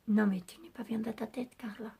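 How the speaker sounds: half-voiced whisper, scolding herself with a laugh in mind; whispered self-scolding. Real, not acted. Vlogger scolds herself about the plastic lollipop, whispering. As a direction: breathe it out like a private aside to yourself.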